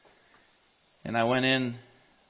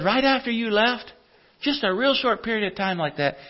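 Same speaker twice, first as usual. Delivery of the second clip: higher-pitched voice for the wife; lightly voiced wife, raised pitch; retold wife; 11 semitones higher than usual. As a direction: relay the wife's account up in pitch. Retells wife's account of the death.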